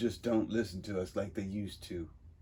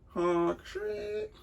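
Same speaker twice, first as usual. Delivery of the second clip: reading sound effects. Shown as a demonstration. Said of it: "reads sound effects".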